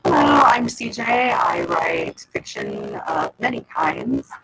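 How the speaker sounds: ordinary talk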